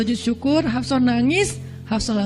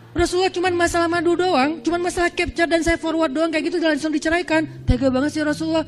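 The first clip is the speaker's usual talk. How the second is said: higher, complaining wife voice, quick at the end; a wife protesting her divorce, higher and whiny; whiny wife complaint; 6 semitones higher than usual. Pretend. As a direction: play a wounded wife griping. Imagined wife complaining about divorce.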